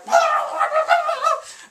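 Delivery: shrill strangled laugh; laugh-choke mid-struggle; high gagging laugh. Retold or imitated. Taylor gag-laughs as aunt forces lemonade down, high strangled laugh.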